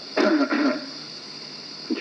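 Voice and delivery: real rattly throat clear. Real, not acted. Radio operator pausing between names, clearing his throat. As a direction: clear your throat mid-sentence. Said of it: "clearing the throat here a bit".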